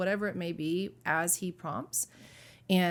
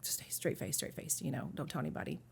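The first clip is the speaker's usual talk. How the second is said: whispered, much softer, telling herself to hold it together; hushed inner voice; 3 semitones lower, 8 dB quieter, faster than usual. Retold or imitated. Holding back tears on the church tour, she whispers to herself. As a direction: murmur it under your breath like a private self-command. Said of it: "And here I am just like".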